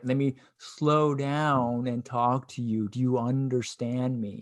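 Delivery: slowed right down, talking down to someone; mock patronizing, drawn-out words for a non-speaker; condescending. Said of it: "it's condescending as fuck".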